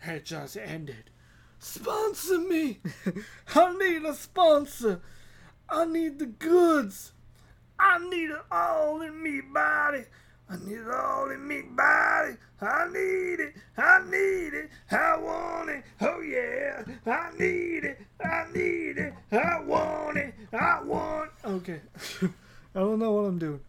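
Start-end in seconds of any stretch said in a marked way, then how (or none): 1.6-7.1 high, pleading ham of a desperate addict; hammed-up craving voice; mock begging. 7.8-10.0 high, whining plea; hammed-up whiny plea; mock craving. 10.5-12.4 high put-on voice shouting BODY; hammed-up yell; mock shouted craving. Hammed-up craving, louder take. 12.6-21.3 high, whiny repeated plea; hammed-up greedy whine; mock craving.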